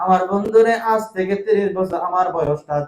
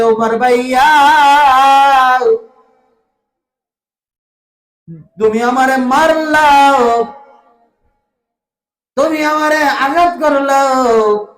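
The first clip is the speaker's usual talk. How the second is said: high, loud chanted lament; acted wail, crying out to a brother; tearful wailing plea; 6 semitones higher, 6 dB louder than usual. Omar's beaten sister pleads with her brother, in an acted wail. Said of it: "চোখের পানি ছেড়ে কান্দে আর বলে".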